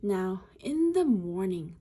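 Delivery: ordinary delivery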